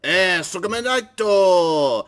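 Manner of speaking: put-on voice. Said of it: "you don't need to do the voice".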